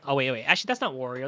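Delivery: ordinary delivery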